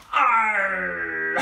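pirate roar to show being loud; loud pirate shout. Shown as a demonstration. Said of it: "But you gotta be loud".

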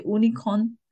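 "unicorn" said as "oonicorn"; wrong pronunciation. Shown as a demonstration. Teacher checking do we say it, mispronounced as oonicorn. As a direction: open "unicorn" on "oo", no Y. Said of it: "If we pronounce".

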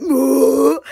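loud mock moo; a loud cow moo; imitated cow moo. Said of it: "It's like".

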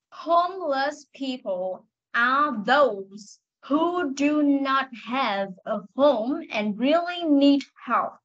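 slow, careful model reading. Shown as a demonstration. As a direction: read it slowly word by word. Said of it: "nghe cô đọc lần cuối". (đọc mẫu thật chậm)

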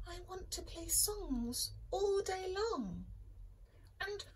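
high little voice; eager tiny radio voice; small radio voice. Acted. Radio speaking.

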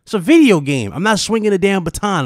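loud, fed-up emphasis on video; stating the obvious, annoyed; exasperated. Real, not acted. Arguing about lightsaber dismemberment, exasperated insistence.

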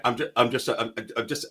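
quiet, halting English stammer; stuttering English accent. Retold or imitated. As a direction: stammer it out softly. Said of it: "a little stutter".

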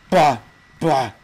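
loud exasperated bah; frustrated shout. Real, not acted. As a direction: blurt it out in frustration. Frustrated outburst in game.

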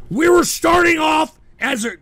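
loud, high-pitched complaint; exasperated shout. Real, not acted. Streamer scolding trolls who spoiled the show, exasperated shout. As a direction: raise your voice in exasperation.

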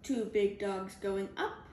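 usual speaking voice

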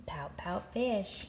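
a soft secret whisper, slow; whispered title. Acted. Whispers title after reading it.

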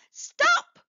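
very high, loud shout; a shrieked command. Acted. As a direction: yell after the drifting broom. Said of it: "Winnie shouted".

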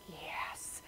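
hushed, breathed "yes"; whispered relief. Retold or imitated. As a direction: let it out in a whisper. Retelling the relief of success.

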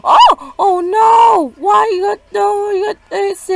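high, loud dismayed cry; upset real shout. Real, not acted. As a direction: yell at the screen in dismay.

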